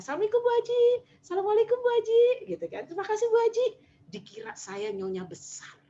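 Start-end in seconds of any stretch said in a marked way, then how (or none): 0.0-2.2 high, sing-out greeting imitating bowing guards; deferential security guards. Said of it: "Langsung satpamnya pada nunduk semuanya". 2.9-3.7 high-pitched imitated guard thanking her; deferential security guards. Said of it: "Langsung satpamnya pada nunduk semuanya".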